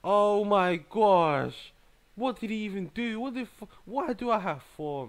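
loud, high cry of frustration; exasperated outburst. Real, not acted. Player losing the match, exasperated. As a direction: start long and loud, then keep protesting high.

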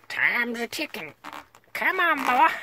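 high, strained cowboy urging; squeaky-high mock cowboy call; put-on high voice. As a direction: push it way up high, hurrying someone along. Urging himself on in character.

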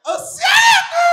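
very high, loud, slow shriek; screeching hawker call. Retold or imitated. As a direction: screech it at the driver. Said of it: "they're like".

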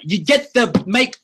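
high, halting broken English; comic high-pitched broken English with pauses. Retold or imitated. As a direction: grope for words in mock pidgin. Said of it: "I'm like".

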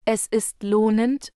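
ordinary talk, nothing marked

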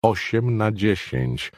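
Osiem na dziesięć spelt out slowly, each syllable hit; every syllable stressed. Acted. Riverdale driving home the photo size to Brockman, slow syllable-by-syllable stress. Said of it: "akcentował każdą sylabę".

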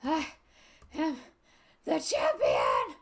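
loud, slow, drawn-out victory proclamation; hammed-up triumphant bellow; champion shout. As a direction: boom it out word by word like a wrestling announcer. Declaring victory after race.

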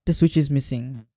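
ordinary talk

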